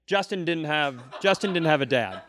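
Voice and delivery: usual speaking voice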